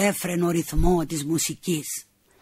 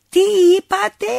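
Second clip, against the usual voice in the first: put-on old woman not hearing; 12 semitones higher, 7 dB louder, faster than usual. Deaf old woman asks.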